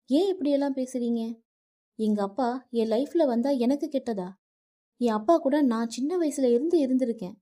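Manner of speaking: wife shouting at her husband over her father; angry shouting. Acted. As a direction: yell at him for insulting her father. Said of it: "Nithya வருணிடம் கத்தினாள்".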